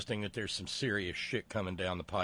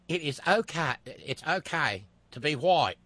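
cheesy Australian accent; 4 semitones higher, 4 dB louder than usual. Shown as a demonstration. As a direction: lay on a corny Australian twang. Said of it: "my cheesy Australian accent here".

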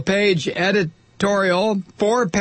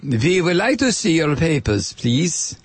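mock German accent of a film Nazi; movie German accent. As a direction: put on a Hollywood German accent. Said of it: "would consider a German accent".

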